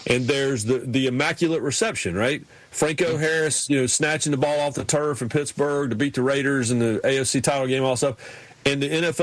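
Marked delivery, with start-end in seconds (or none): none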